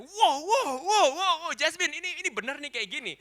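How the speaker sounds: high, loud repeated mock screams; panicked ride yelling. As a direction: yell in hammed-up panic to your daughter. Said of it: "saya yang gini".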